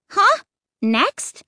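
high startled huh then flat next; incredulous cry. Acted. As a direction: jump up in disbelief. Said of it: "I cried".

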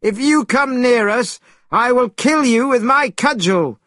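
high-pitched, forceful threat; Monkey's threatening cry. A narrator's reading. Monkey threatens a suspected demon, crying out high. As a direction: cry it out high, as a fierce warning. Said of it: "cried Monkey".

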